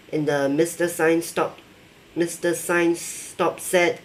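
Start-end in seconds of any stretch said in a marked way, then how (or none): none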